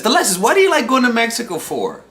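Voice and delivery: high-pitched imitation of a questioner; imitated nosy questioner. Someone asking why he likes Mexico, high mock-curious voice.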